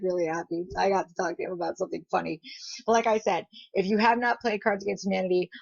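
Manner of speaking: normal talking voice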